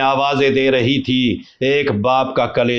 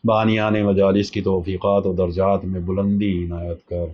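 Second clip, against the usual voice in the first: closing prayer; 3 semitones lower than usual. Acted. Closing supplication after majlis. (آہستہ دعائیہ لہجہ)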